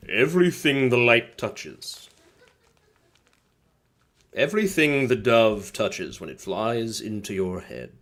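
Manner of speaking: lightly voiced solemn lion king; a hint of a regal lion father; Mufasa. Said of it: "Mufasa vibes".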